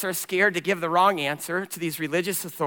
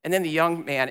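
ordinary talk

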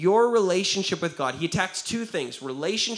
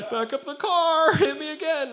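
much higher, a bit louder mock pleading; higher hammed-up pushover begging for another hit; mock doormat voice; 12 semitones higher, 4 dB louder than usual. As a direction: ham up a willing victim inviting more. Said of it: "doesn't lay down and go".

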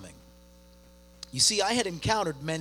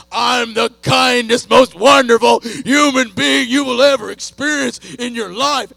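yelling; 6 semitones higher, 16 dB louder than usual. Retold or imitated. Dad yells at his daughter that he is the kindest. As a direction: shout your own kindness without irony. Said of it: "as I'm yelling at her".

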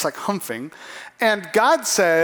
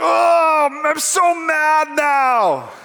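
loud, higher, slowed-down angry yell; hammed-up tantrum, louder and higher; mock furious shout; 7 semitones higher, 3 dB louder, much slower than usual. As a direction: drag out the rage like a sulking prophet. Jonah furious over the plant.

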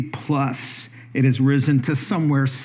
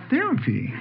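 high, baffled "Therapy?"; incredulous; 10 semitones higher, 3 dB quieter than usual. Retold or imitated. Husband baffled by shopping as therapy, high incredulous echo. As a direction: disbelief pitched way up. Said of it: "I'm like".

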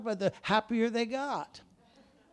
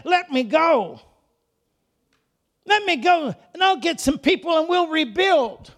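Nehemiah volunteering; 9 semitones higher, 7 dB louder than usual. Acted.

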